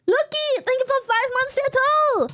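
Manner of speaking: high, loud bright thank-you; excited thanks. Real, not acted. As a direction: thank them with a burst of excitement.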